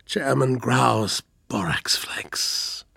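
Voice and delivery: slow, feeble whisper. Acted. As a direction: barely breathe out the words. Dying man's last words.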